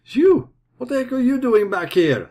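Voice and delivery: high-pitched, loud shopkeeper; high clerk voice. Acted. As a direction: squawk it like a startled clerk. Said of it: "says the clerk".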